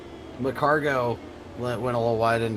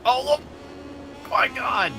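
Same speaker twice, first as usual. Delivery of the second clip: excited shout; 13 semitones higher than usual. Real, not acted. Sudden crash on screen.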